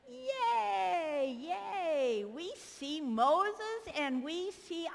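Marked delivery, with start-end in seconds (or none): 0.1-2.2 put-on high cheering yay for the kids; mock excited cheer. Shown as a demonstration. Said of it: "Can you act excited?".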